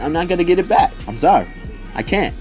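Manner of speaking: ordinary delivery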